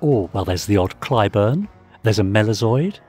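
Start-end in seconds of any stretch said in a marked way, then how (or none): none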